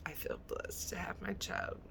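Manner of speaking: choked up, near tears; softly whispered through real tears; tearful whisper. Real, not acted. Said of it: "See, I almost start crying again".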